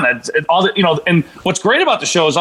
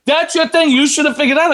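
mocking scold; 11 semitones higher than usual. Fans scolding him over the karaoke, high mocking taunt. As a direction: parrot the critics in a high mocking voice. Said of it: "I kept getting hate from everyone going".

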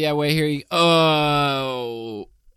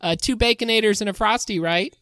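high, lightly voiced Wendy; 7 semitones higher than usual. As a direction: play Wendy with a raised voice. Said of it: "it's me, Wendy".

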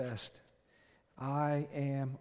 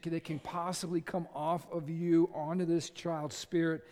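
normal talking voice

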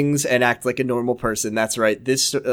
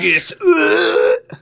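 mock award announcer; 3 dB louder, much slower than usual.

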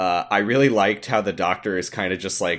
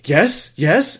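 eager; 8 semitones higher, 5 dB louder than usual. Retold or imitated. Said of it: "he's like".

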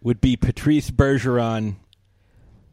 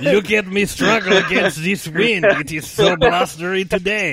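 higher, hammed-up French Canadian mime; Patrice Bergeron; 4 semitones higher than usual. Mime narrating his struggle, mock French Canadian accent. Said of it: "does not sound like that".

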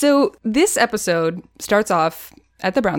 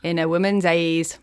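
imitating an Irish accent; 3 semitones lower than usual. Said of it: "he's practicing this accent".

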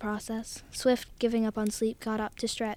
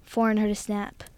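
ordinary delivery

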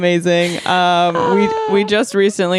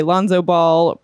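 ordinary talk, nothing marked

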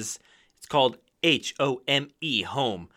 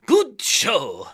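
theatrical Burnham; 7 semitones higher than usual. Acted. Burnham cheering the dinner plan, theatrical. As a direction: ham up the closing line. Burnham's closing line in the scene.

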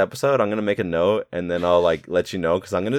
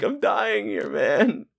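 much higher whiny plea; whiny Stu; much slower than usual. Retold or imitated. Stu bleeding out and complaining, high and whiny. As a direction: whine it like an unprepared kid. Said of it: "he's like".

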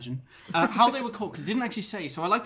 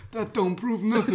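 lightly voiced New Jersey boss; a hint of New Jersey accent; Jersey mob boss; 4 semitones higher than usual. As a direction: shrug it off like a Jersey mobster. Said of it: "He's like".

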